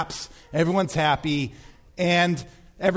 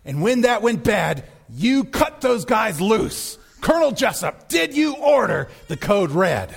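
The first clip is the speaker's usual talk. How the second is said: raised, accusing courtroom cross-examination; Tom Cruise impression; 3 semitones higher than usual. Lawyer questioning the colonel in court, mounting accusation. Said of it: "And then he continues".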